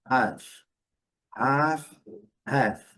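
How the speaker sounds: half said with a long open a and no L sound; half without L. Shown as a demonstration. Teacher modeling half for the class. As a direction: stretch the a in half, drop the L. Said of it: "um pouco mais longa e aqui um pouco mais curta".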